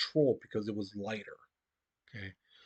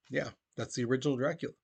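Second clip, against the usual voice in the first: normal talking voice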